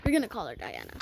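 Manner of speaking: ordinary talk, nothing marked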